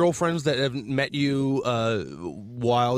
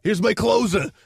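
construction worker voice; 6 semitones higher, slower than usual. Acted. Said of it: "construction worker voices".

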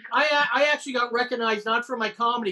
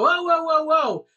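higher, mildly raised retold "whoa"; mock alarmed call to stop; cops calling out; 6 semitones higher, 4 dB louder, slower than usual. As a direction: imitate cops halting someone.

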